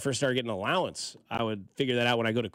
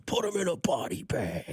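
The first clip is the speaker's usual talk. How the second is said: former engineer's catchphrase, higher and drawn out; John Morris; 5 semitones higher, slower than usual. Retold or imitated. Co-host quoting the old engineer's catchphrase, drawn-out impression. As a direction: stretch it out like a signature call. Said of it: "He would always come in and say that".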